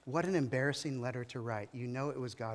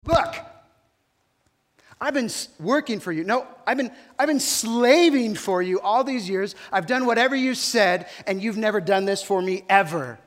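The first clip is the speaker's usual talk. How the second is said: much louder and higher, ranting at his father; angry outburst; 5 semitones higher, 12 dB louder than usual. Acted. Older brother confronting his father about the party, furious rant. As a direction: hurl the grievance at him, furious.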